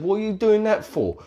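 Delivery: high-pitched, incredulous question; acted-out scoffing query; baffled challenge. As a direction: demand an explanation in mock disbelief.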